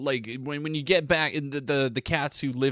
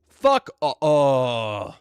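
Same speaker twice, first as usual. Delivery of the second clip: sudden outburst; 3 semitones higher, 9 dB louder, much slower than usual. Real, not acted. Outburst reacting to the news.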